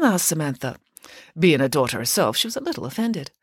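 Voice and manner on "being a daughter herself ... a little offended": plain reading voice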